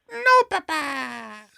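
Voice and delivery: put-on high, drawn-out little child voice; German child. Child answering Papa, high stretched-out German kid voice. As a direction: whine it like a small child with a German accent. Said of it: "German accent as a child".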